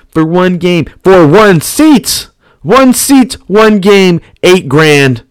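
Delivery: high, punched short phrases; emphatic amazement. Retold or imitated. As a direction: hammer each phrase with rising excitement. Hammering home the shocking price.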